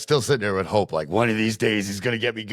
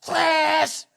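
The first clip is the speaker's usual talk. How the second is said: high, whining half-laughing cry; hammed-up crying plea; mock-wailing plea; slower than usual. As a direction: wail at the kid in mock despair. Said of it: "oh boy".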